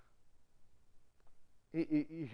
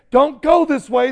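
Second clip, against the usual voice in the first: loud, higher urgent warning; warning a driver at the roadside, shouting; 9 semitones higher, 18 dB louder than usual. Retold or imitated. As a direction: stop them going further.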